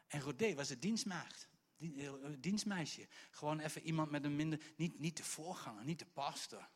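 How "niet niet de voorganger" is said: normal talking voice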